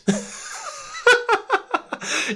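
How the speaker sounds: long, high burst of laughter; big laugh. Real, not acted. Reacting to the retold dismissal, a long high laugh. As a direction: crack up helplessly.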